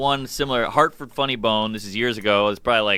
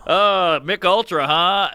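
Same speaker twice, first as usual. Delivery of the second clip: higher-pitched reenacted joke delivery; onstage punchline; 4 semitones higher, slower than usual. Comic trying a punchline on a crowd, bombing stage delivery. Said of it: "Like, I'd be like".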